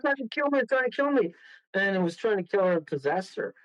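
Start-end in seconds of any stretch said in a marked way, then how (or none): 0.0-1.3 retold high, frantic alarm; imitated terror, pitched way up; panicked cry. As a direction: cry out as the victim. Acted attacked woman crying out.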